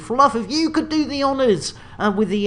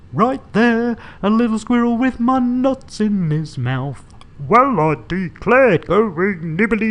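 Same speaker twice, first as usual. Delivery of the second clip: silly character voices; 3 semitones lower, 3 dB louder than usual. Acted. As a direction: ham up a little chat about a squirrel on the ledge. Acted squirrel dialogue voices.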